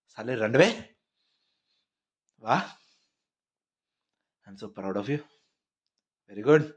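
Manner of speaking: a hint of an angry dad taunting his son; half-mock furious father; scolding father.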